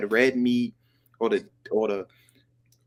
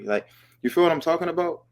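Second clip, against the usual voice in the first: ordinary talk, nothing marked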